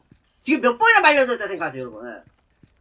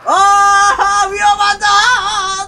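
high, loud panicked warning; urgent gamer panic; alarmed shout; 9 semitones higher, 9 dB louder, much slower than usual. Real, not acted. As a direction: yell to run away. Danger, urging escape in game.

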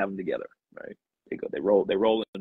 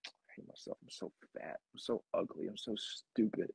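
whispered self-talk; 5 semitones lower, 11 dB quieter than usual. Retold or imitated. A person alone at home whispering insults at themselves. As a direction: whisper it like the mean voice in your head. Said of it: "what do we do?".

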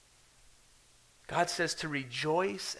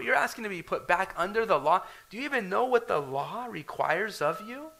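rising challenge; 6 semitones higher, 5 dB louder than usual. Acted. Paul's challenging questions, voiced.

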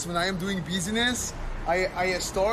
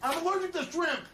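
outraged; 10 semitones higher, 4 dB quieter than usual. Acted. Outraged about shrimp.

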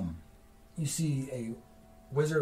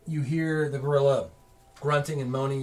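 ordinary talk, nothing marked

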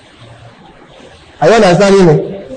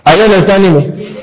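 ordinary talk

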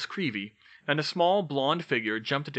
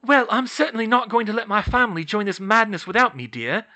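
high, loud, indignant mother; fierce motherly insistence; 10 semitones higher, 7 dB louder than usual. A narrator's reading. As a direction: declare it fiercely, pitched up. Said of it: "Molly said fiercely".

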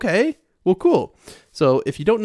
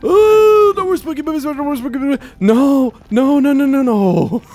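very high, loud despairing wail; 12 semitones higher, 7 dB louder than usual. Real, not acted. Lost in nether, despair.

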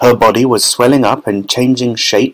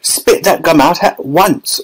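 firm order; 6 semitones higher, slower than usual. A narrator's reading. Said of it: "ordered Mister Beauregard".

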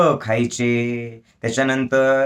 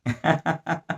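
short, soft laugh; soft chuckle; 4 semitones higher, 5 dB quieter than usual. Real, not acted. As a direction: chuckle lightly at your own joke. Laughs after his joke about Babu. (हलकं हसू)

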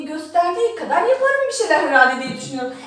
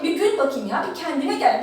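normal talking voice

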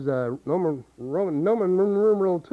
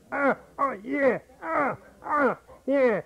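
higher, strained and pinched; squeezed larynx; 7 semitones higher, slower than usual. Shown as a demonstration. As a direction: grunt it out with a tight throat. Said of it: "suppress that larynx".

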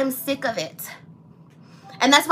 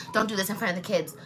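hushed mocking of his scolding; quoting ex, quiet mock; 5 semitones lower, 7 dB quieter, faster than usual. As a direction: softly parrot his warning. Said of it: "he had the nerve to say".

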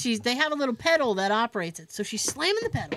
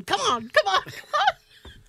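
rising, pleading higher and higher; 9 semitones higher than usual. Retold or imitated. Hygienist coaxing the dead polisher, pleading high.

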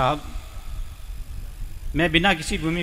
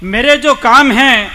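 raised, projected mushaira verse; poem recitation; 5 semitones higher, 6 dB louder, slower than usual. Acted. Poet tells audience what his work serves, reciting. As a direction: declaim the couplet in a higher pitch.

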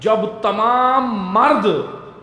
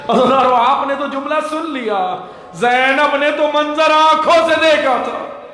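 impassioned lament preaching; 7 semitones higher, 3 dB louder than usual. Acted. Orator to mourners about what Zainab saw, impassioned lament. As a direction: let each phrase swell into a loud wail.

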